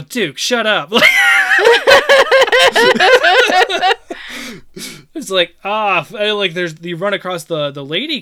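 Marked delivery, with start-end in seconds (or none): 0.0-0.9 mock exasperation. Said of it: "I'm like". 0.9-5.1 loud burst of real laughter; big high laugh. Real, not acted. Cracks up at own joke.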